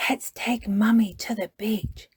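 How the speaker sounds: breathy secret whisper. Acted. Peppa secretly telling Daddy her plan, whispering. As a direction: whisper a plan behind Mummy's back. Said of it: "Peppa whispered to Daddy Pig".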